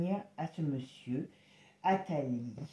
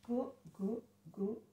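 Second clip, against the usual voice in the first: ordinary talk, nothing marked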